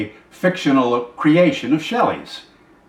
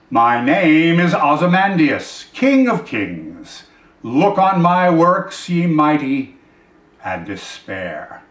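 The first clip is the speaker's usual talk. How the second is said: proclaiming king; 3 semitones higher, 3 dB louder, slower than usual. Acted. Poem reader voicing the king's inscription, slow and imperious. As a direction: proclaim it grandly, then drop away on the last words. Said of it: "on the pedestal these words appear".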